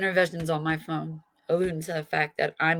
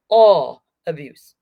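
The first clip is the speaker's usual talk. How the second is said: slow, loud and hammered; loud slow emphasis; 11 dB louder, much slower than usual. Real, not acted. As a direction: drive "all abuse" home slowly. Campaign plea peaks.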